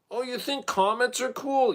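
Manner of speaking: very high put-on voice; high-pitched playful question; mock impressed question. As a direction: tease the listener in a high, silly voice. Acted address, comparing dog to comet.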